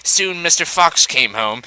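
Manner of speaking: plain reading voice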